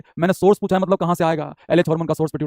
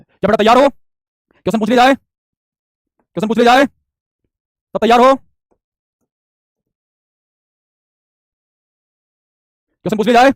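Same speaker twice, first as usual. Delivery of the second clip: higher, louder call rising at the end; rallying call-out; 4 semitones higher, 5 dB louder, slower than usual. Real, not acted. Teacher asks if students are ready for questions, rallying call. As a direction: call out to the class, lifting each question.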